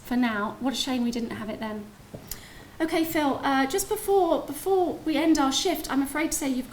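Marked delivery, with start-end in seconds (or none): none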